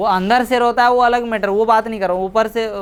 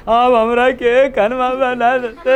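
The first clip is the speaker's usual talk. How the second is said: slow, drawn-out imitation of a weeping mother's wail; mock wailing lament; 4 semitones higher, slower than usual. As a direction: wail it like a mourning mother at the farewell, half in jest. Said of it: "वो रोएंगे".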